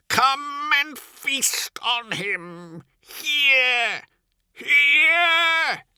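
top-of-the-voice shriek, very high; high, frantic full-voiced screaming. A narrator's reading. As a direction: scream it out, each cry drawn out. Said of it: "He screams at the top of his voice".

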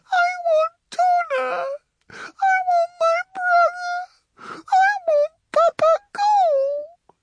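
put-on high, slow, sobbing little-girl plea; wailing child. A narrator's reading. Sobbing girl calling for her papa, wailing. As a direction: cry out for family in a tiny, very high voice. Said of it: "Yonsei wailed".

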